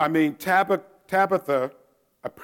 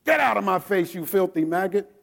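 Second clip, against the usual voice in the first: louder, higher gruff dismissal; drill instructor insult; 6 semitones higher, 4 dB louder than usual. Retold or imitated. Flustered drill instructor dismissing the private, loud bark. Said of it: "He said".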